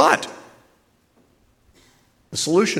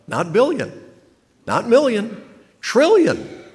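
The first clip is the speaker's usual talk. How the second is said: each word higher and louder up to trillion; rising emphasis; 10 semitones higher, 5 dB louder than usual. Acted. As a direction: climb to a big punch on trillion.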